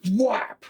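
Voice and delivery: high punchy whap; comic impact noise; sound effect whap. Acted. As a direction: snap out the hit like a cartoon smack. GM narrating orc attack sound.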